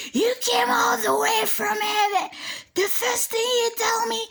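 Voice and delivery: high-pitched indignant mock scream; retold yelling and screaming. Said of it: "I yelled and screamed".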